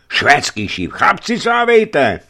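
high, loud, drawn-out call to arms; alarm call. A narrator's reading. Petruška warns his comrades of the Swedes, loud high alarm. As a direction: rouse the men now.

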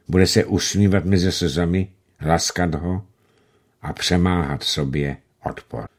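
ordinary delivery

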